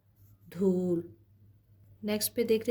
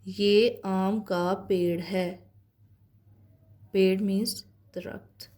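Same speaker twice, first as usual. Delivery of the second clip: very slow, drawn-out sentence reading; slow dictation reading; slower than usual. Acted. Teacher reading a practice sentence to her class, very slow. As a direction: stretch every word so children can follow.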